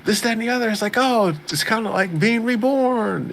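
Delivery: put-on high-pitched gushing nerd girl; Shizune. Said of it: "she is just a full-on".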